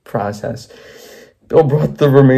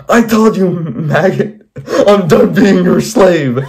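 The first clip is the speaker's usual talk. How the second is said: high, hammed-up yelling; acted scream; 6 semitones higher, slower than usual. Bill yells at Henry, his maker, acted scream. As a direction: scream it in mock fury. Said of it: "Bill screamed as loud as his voice box could go".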